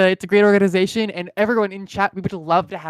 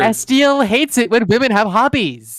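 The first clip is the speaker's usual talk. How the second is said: mock accusation; 6 semitones higher, 7 dB louder, slower than usual. Teasing, loud slow.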